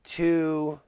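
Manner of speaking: usual speaking voice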